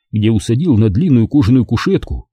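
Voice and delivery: plain reading voice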